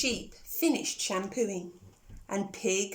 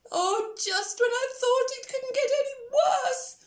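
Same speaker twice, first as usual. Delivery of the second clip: much higher, louder despairing pig; groaning; 13 semitones higher, 5 dB louder than usual. Acted. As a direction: groan in fed-up misery. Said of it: "groaned Pig".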